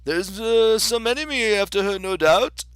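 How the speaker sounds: high, loud storybook king. Acted. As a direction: proclaim it as a king.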